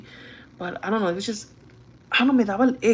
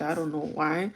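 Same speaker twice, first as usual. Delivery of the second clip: ordinary talk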